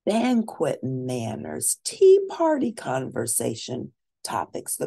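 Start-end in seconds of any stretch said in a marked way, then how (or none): none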